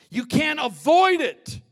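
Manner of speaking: sudden loud cry of warning; preacher shouting the warning out; shouted warning. Real, not acted. Preacher to congregation, a sudden loud warning.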